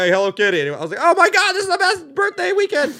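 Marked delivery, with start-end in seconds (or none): 0.1-0.6 loud, higher-pitched holler; mock calling out. Stranger hollers at the guy in the shirt, mock calling out. Acted heckle calling out to him. 1.0-2.9 very high, loud party squeal; ecstatic yelling. Retold or imitated. Birthday partier yelling at the beach club, ecstatic.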